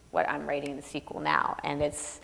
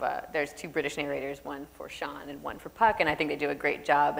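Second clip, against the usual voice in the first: ordinary talk, nothing marked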